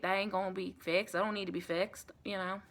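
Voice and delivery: ordinary talk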